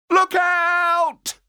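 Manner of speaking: high alarmed yell; shouted warning. Acted. Kids spot monster.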